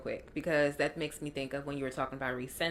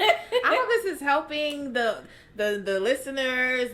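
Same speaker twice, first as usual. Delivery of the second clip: laughing; 7 semitones higher, 7 dB louder than usual. Real, not acted. Laughing hope for listeners.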